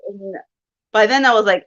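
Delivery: ordinary delivery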